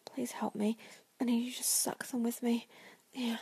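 ordinary talk, nothing marked

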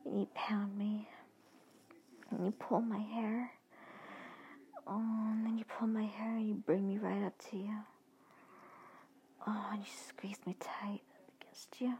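ordinary delivery, nothing marked

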